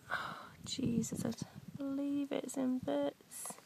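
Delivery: soft, slow unvoiced whisper, gutted; whispered dismay. Real, not acted. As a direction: breathe it out, crushed by the broken find. Second broken find, dismay.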